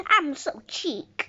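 usual speaking voice

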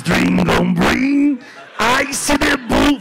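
slow shouted fake English; mock English. Comic to audience: slow shouted fake English. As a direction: belt out made-up English, dragged out. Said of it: "I says".

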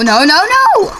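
high, loud noes; panicked cry. Real, not acted. Gamer falling after a wrong answer, panicked cry. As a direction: cry out as you slip. Failing in the game.

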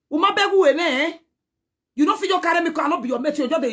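high, loud heated scolding; fired-up complaint; angry rant. Real, not acted. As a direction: tell off someone who insulted you.

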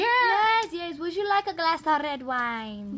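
mock French waiter offer; put-on French; French accent. Shown as a demonstration. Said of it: "The French accent".

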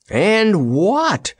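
high, drawn-out question; anxious query. A narrator's reading. Dorothy questions Jellia with scared eyes, anxious query. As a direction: cut in wanting the rest. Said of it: "queried Dorothy".

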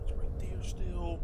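slow unvoiced whisper. Real, not acted. Streamer hiding from the monster, whisper. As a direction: hush so the thing won't hear.

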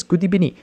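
"good evening" said as "goodee-benin"; coach's mispronunciation. Retold or imitated. Quoting the football coach, "good evening" mispronounced as "goodee-benin". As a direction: imitate an accented "good evening", v turned to b. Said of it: "And he says".

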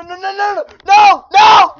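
loud rising cries of no; desperate no, no, no; panicked shouting. Real, not acted. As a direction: yell in real panic as it slips away. Panicking as he falls.

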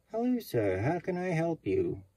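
high, bright greeting; cheery bank teller. Retold or imitated. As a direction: chirp the hello way up. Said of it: "the single teller".

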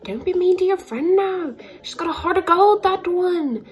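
high, loud, hammed-up Irish scolding; attempted Irish accent. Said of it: "that was supposed to be my Irish".

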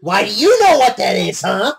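high, loud playful taunt; mock her with loud glee. Real, not acted.

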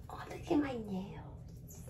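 breathy whisper to herself; tipsy whisper over your own nails. Real, not acted. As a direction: hush it, admiring your nails. Drunk aside, admiring her nails.

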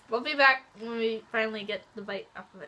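words muffled by chewing; talking while chewing. Real, not acted. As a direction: mouth partly full of chocolate. Seen 1: Both girls bite and chew chocolate; unclear which one speaks.

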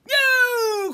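high, drawn-out pouncing cry; loud cry. A narrator's reading. Fox leaping at the birds, high loud cry. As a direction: cry it out at full volume. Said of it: "cried Foxy Loxy".

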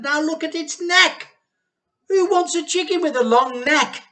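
high, loud indignant scolding; mock the failed chicken; scornful complaint. A narrator's reading. Missus Cranky complaining to the men, high scornful voice.

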